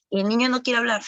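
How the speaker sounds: ordinary delivery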